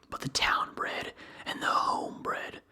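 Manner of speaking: ordinary delivery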